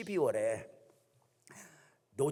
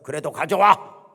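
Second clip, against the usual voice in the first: loud, slow, insistent command; stubborn demand; 4 semitones higher, 14 dB louder than usual. Acted. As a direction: plant your feet and demand it slowly. Option one, Elijah-like stubborn demand.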